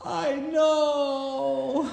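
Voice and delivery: high, loud laughing cry of I know; laughing shout. Real, not acted. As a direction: laugh through a mock wail. Crying kid quote, laughing.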